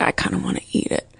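quick hushed whisper. Retold or imitated. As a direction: whisper it fast to yourself. Contrarian inner voice, hushed quote.

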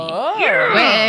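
high, loud, drawn-out oh; excited squeal. Real, not acted. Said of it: "Made me so excited".

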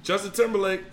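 usual speaking voice

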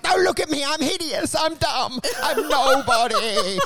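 high, cowering self-pitying little voice; meek hidden genius. Pretend. Timid genius begging the visitor not to look, high and cowering. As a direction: shrink away from the visitor, pleading.

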